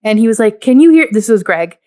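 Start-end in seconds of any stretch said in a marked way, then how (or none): 0.6-1.1 raised, higher retold call; mock calling out. Recapping Greg at the giant ear, calling out. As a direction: imitate someone checking they can be heard. Retold contestant checking he's heard.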